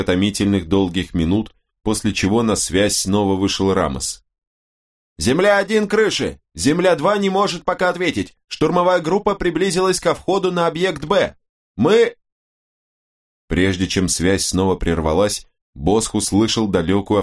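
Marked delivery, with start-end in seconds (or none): 5.2-12.2 very high, strained battle shout; shouting over radio. A narrator's reading. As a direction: yell the report under fire. (крик по рации из боя)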